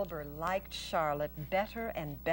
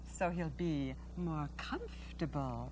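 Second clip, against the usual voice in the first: hushed, gentle reassurance; soft spider aside; 3 semitones lower, 7 dB quieter than usual. Acted. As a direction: murmur it tenderly.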